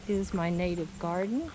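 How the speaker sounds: normal talking voice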